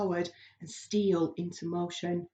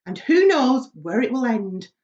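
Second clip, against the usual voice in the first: gloomy mutter; 4 semitones higher, 8 dB louder than usual. Acted. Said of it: "muttered the earthworm".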